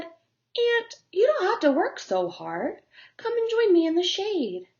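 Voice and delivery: high, coaxing bug voice; playful high invitation; coaxing grasshopper. Acted. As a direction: lure her into the shade. Grasshopper calls to the ant.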